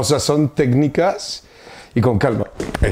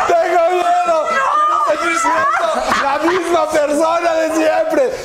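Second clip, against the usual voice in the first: scared mind voice; 11 semitones higher, slower than usual. Pretend. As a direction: cry out fearfully as the inner mind. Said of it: "Yo soy su mente ahora".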